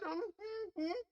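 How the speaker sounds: a short high laugh at his own joke; high giggle. Real, not acted. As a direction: break into a squeaky laugh.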